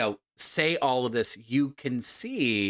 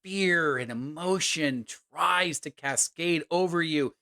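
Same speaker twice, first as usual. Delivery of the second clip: raised, intense storyteller voice; dramatic narration; 5 semitones higher than usual. A narrator's reading. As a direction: build the tension.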